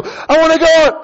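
high, loud frantic reply; panicked mock yell. Skydiver to instructor before the push, panicked yell. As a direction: yell back, cut off mid-answer.

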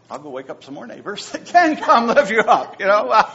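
loud laughing aside; joking as he laughs. Real, not acted. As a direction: laugh through the thought. Laughing at own thought.

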